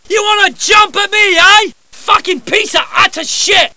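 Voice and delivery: cocky British thug taunting enemies; tough soldier barks. Acted. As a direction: snarl and shout like a brawler. Voice actor records combat barks.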